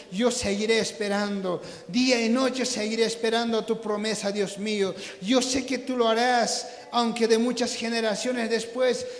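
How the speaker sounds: hushed, insistent prayer after the fervour; earnest prayer. Real, not acted. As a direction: pray it quietly with settled trust. Leads a softer prayer.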